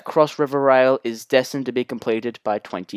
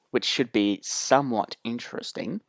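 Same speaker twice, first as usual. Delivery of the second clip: ordinary delivery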